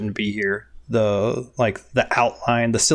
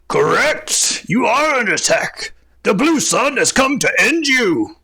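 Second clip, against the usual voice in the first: high, loud draconic roar; half dragon voice; 11 semitones higher, 5 dB louder than usual. Acted. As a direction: boom it out like a gloating dragon. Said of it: "a gravelly draconic voice".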